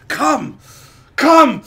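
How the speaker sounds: sudden high, loud bark of an order; screamed command. Retold or imitated. Retelling the ASMR roleplay guy, screamed command. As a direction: yell it as a mock command, far above talking pitch. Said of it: "starts screaming".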